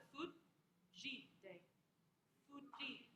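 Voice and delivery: "Fujite" with a stop, then a long "JEE"; stop, then lean on the middle of "Fujite"; middle syllable stressed. Shown as a demonstration. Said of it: "middle syllable is the one".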